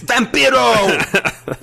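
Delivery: high, drawn-out announcer yell of the name; terrible Vampiro impression. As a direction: ham up a fake Vampiro intro. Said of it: "That was a terrible Vampiro impression".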